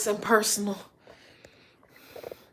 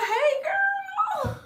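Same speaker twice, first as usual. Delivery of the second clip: excited; 3 dB quieter than usual. Real, not acted. Greeting viewer on live.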